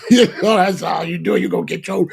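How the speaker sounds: loud laughing aside. Real, not acted. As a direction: break up laughing mid-line.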